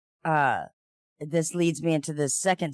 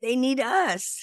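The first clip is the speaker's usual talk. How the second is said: mock horse motto; 9 semitones higher, slower than usual.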